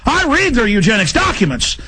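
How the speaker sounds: usual speaking voice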